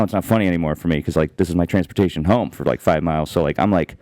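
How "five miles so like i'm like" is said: ordinary talk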